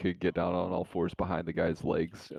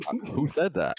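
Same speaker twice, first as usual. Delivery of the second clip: high, louder put-on query; mock questioning; 10 semitones higher, 5 dB louder than usual. Player acting out a bluff, mock questioning. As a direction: play at asking who spoke, pitched up.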